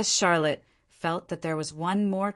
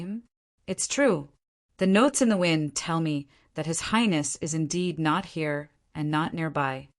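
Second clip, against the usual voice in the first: ordinary delivery, nothing marked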